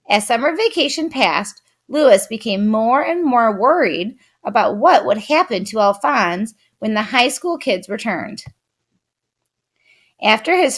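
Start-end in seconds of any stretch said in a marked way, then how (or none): none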